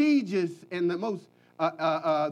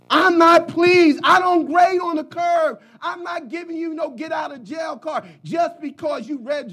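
stern shout; 7 semitones higher, 5 dB louder than usual. Acted. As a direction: thunder the Lord's displeasure down. Preacher voicing the Lord's displeasure.

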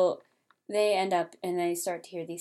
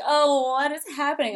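higher, louder fake fright; mock alarm; 7 semitones higher, 6 dB louder than usual. Son sees the robots, mock alarm. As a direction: gasp it out in mock shock. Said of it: "he's like".

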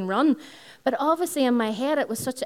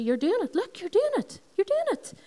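rising high sing-song encouragement; encouraging friend; 11 semitones higher than usual. Retold or imitated. Imitating her nurse friend cheering her on, pitch climbing high. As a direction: lift each line higher to cheer her on. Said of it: "she would change it to".

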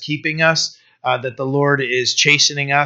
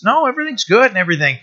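mock sweet-talker; 7 semitones higher, much faster than usual. Mimicking deceitful smooth talkers.

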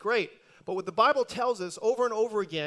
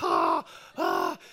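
low strained gasping; frazzled run-over mice gasping to God; frazzled mouse gasps. Acted. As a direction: gasp like a worn-out mouse. Frazzled mice arriving in heaven.

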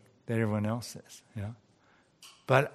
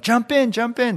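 high, loud urgent shout; yelled call; 10 semitones higher, 7 dB louder than usual. Retold or imitated. Motorboat rescuer shouting to the man on the roof, high and loud. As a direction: shout over the motor to jump. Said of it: "came in a motorboat and yelled".

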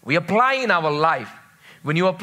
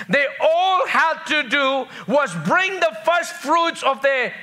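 high-pitched, ringing scripture proclamation; raised preacher's voice; emphatic preaching; 7 semitones higher than usual. Real, not acted. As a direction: drive each phrase home like a preacher.

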